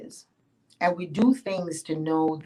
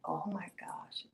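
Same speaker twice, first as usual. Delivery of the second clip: soft whispered oh my gosh; awed whisper; 2 semitones higher, 14 dB quieter than usual. Acted. Yemonja hearing the secret, whispering in awe. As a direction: breathe it out in awe.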